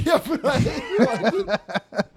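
loud, high, cracking up; laughing while talking. Real, not acted. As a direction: try to get words out through real laughter. Cracking up after failed jokes.

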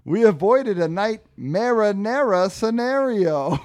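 high, loud theatrical punchline; punning pepperoni Frank. Acted. Frank celebrates the escape to Ernie, hammed-up relief. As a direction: ham up the rhyme. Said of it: "And Frank goes".

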